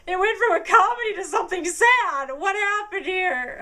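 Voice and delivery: high, loud bewildered outcry; baffled disbelief. Retold or imitated. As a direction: sound thrown by the sudden sadness. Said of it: "I was like".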